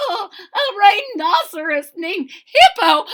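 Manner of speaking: high, loud laughing shout; Fluffy crying out with laughter at the name. Acted. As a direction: cry it out laughing. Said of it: "Fluffy cried".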